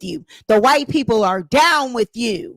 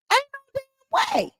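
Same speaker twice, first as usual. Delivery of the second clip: very high, squealing disbelief; 4 dB quieter, faster than usual. Real, not acted. Commentator laughing off the man, squealing in high disbelief.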